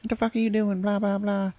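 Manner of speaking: high squeaky shocked woman; mock outraged lady voice; shocked bank lady. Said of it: "she's all".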